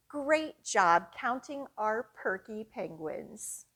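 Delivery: ordinary delivery, nothing marked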